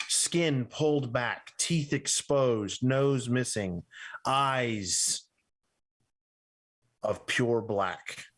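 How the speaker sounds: slow, ominous scene narration; drawn-out horror narration with pauses; game master's scene setting. A narrator's reading. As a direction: describe the creatures in measured, separated phrases.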